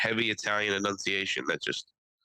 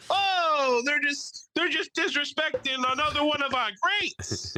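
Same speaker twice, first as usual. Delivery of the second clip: much higher, hammed-up indignant Italian voice; mock offended Italians; slower than usual. Host mocking Dave and Jody defending their favorite comic, pitched high. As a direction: whine like an offended Italian fan. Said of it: "They're gonna sit here and go".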